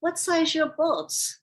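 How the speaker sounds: imitated Russian accent. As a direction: repeat it as an insistent Russian man. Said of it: "in his Russian accent".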